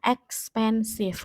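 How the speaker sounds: expensive slowly, split into ex-pen-sive; syllable by syllable. Shown as a demonstration. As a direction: count out the syllables of "expensive" aloud. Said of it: "dihitung dari kita mengucapkannya".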